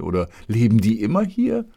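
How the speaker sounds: put-on a thrilled boy shouts his questions at the deer; jubilant cry. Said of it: "rief er jubelnd".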